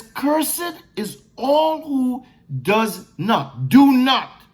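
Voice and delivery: slow, weighty, each phrase spaced out; slow solemn proclamation; solemn scripture reading. Acted. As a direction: read it like the law itself, deliberately. Reads scripture slowly again.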